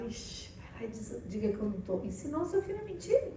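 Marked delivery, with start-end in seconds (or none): 0.0-2.0 whispered, secretive instruction; hushed whisper. Retold or imitated. Parent whispers to the child to say she's not home. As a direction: whisper so the caller can't hear. Parent hides from phone call.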